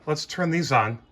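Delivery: ordinary talk, nothing marked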